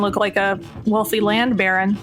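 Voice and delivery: ordinary talk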